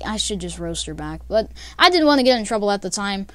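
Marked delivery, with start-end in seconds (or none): 0.0-1.2 softer and lower, under the breath; inner thought. Retold or imitated. Narrator recalling his silent thought in class: quiet inner voice. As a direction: think it quietly to yourself. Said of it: "in my head".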